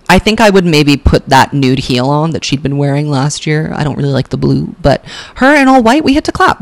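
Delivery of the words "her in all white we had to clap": high, gushing we had to clap; excited gush. Real, not acted. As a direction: squeal it with delight.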